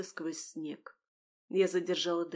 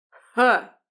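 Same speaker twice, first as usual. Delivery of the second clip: loud, higher burst of effort; forceful exhaled "ha"; 4 semitones higher, 13 dB louder than usual. A narrator's reading. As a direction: huff it out as the axe drops. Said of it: "выдохнул Михаил и опустил топор".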